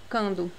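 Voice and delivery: cando as kan-do, no w after k; U dropped. Shown as a demonstration. Teacher demonstrates the mistaken form cando, U dropped. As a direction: drop the U sound, giving cando. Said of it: "Não pronuncia cando".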